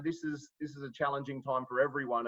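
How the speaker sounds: ordinary talk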